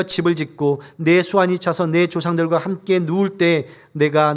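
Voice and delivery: measured, formal Bible reading voice; solemn scripture recitation. Acted. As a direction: read the verses aloud with weight. Reads scripture aloud formally.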